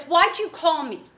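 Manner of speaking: loud, slow, suspicious challenge; loud and wary, each word pressed; forceful demand. Retold or imitated. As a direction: bark the question at a cold caller. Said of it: "forceful about it".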